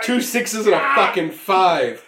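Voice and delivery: high, loud outburst at the dice; real shocked outcry; loud disbelief. Real, not acted. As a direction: exclaim it over the table's uproar. Reacting to disastrous dice roll.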